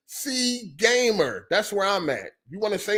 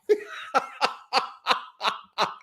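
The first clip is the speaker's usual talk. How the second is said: breathy laugh; 3 semitones higher, 5 dB quieter than usual. Real, not acted. Laughing at own bit.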